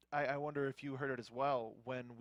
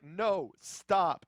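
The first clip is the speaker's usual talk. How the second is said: imitating a firm, louder "stop"; retold urgent halt; 3 semitones higher, 7 dB louder than usual. Panelist retells wrestler halting a teammate, firm and loud. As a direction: punch each word as a separate command.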